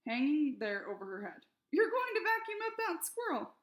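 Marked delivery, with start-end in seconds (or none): 1.7-3.6 very high, loud alarmed yell; shouted warning. A narrator's reading. Flora yelling at the neighbor through the window. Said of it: "She said the words".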